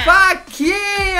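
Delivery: faquinha dragged out slow and high. Pretend. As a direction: stretch faquinha very slowly. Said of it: "diga faquinha".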